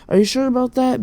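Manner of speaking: high-pitched doubting question; imitated skepticism. Counselor doubts his denial, retold.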